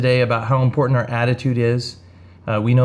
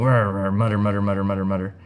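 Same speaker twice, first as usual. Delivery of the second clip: low, softer mock mumble of grumbling; 5 semitones lower, 4 dB quieter, faster than usual. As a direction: grumble under the breath in mock words. Grudging woman grumbling.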